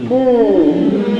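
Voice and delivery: "mm" as a long nnn hum; sustained n sound for "mm"; held N sound. Shown as a demonstration. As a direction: hum "mm" with tongue on the roof, lips apart. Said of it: "tongue now is up against the roof".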